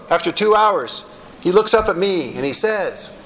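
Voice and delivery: ordinary delivery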